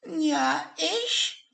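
very high plush-penguin voice; high squeaky Pingu voice. Acted. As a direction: answer like a tiny stuffed penguin. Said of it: "antwortete Pingu".

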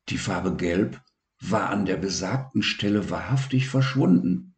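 ordinary delivery, nothing marked

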